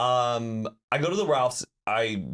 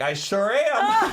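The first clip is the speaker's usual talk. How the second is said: much higher, slow and laughing; imitating the YouTuber answering his fan, slow and amused; proud cart guy; 11 semitones higher, 5 dB louder, much slower than usual. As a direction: drawl it out like a proud local hero.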